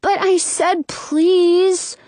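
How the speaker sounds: put-on high, drawn-out child's whine; whining. A narrator's reading. Brother begging for an apple, whining. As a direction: stretch it into a whine. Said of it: "he whines".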